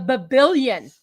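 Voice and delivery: b-b-billion, stuttered then punched; stressed billion. Real, not acted. Host stressing the fortune to co-host, "billion" punched. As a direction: stammer into "billion" for emphasis. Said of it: "Not million".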